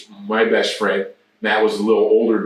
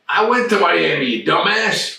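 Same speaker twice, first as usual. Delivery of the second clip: high, mocking voice ribbing the kid; Ted teasing the teen, mocking; teasing veteran; 8 semitones higher than usual. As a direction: needle him like an old teammate. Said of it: "he goes".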